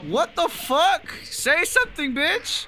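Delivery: high, drawn-out outburst; exasperated demand. Real, not acted. Demands a reply from silent player.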